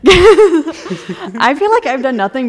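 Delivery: laughing. Real, not acted. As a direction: laugh as you admit it. Laughs admitting she rants.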